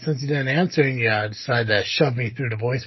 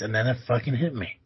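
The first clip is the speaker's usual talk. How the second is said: ordinary talk, nothing marked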